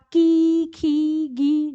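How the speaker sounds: की खी गी sounded out with a long ee; demo with ee vowel. Shown as a demonstration. Teacher sounding out की, खी, गी for pupils. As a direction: sound out की, खी, गी one by one. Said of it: "ई की ध्वनि निकालिए".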